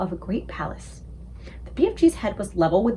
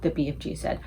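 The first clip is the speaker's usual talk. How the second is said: ordinary delivery, nothing marked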